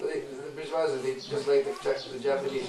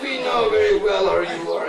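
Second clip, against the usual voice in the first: scornful retort; 6 semitones higher, 8 dB louder than usual. Acted. Mothers reject him again.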